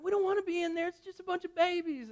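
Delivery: high-pitched mock child complaint; imitating upset kids. Kids protesting to dad about the baby room, high mock whine. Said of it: "they're mad at me".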